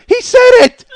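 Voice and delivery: very high, loud triumphant squeal; gleeful shriek. Retold or imitated. Wife tells her office the prank worked, shrieking in glee. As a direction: imitate a wife shrieking the news to the room.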